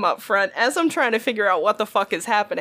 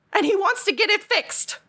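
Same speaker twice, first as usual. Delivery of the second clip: high-pitched disbelief at his nerve; incredulous; 10 semitones higher than usual. Real, not acted. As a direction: squeak it out, can't believe the gall.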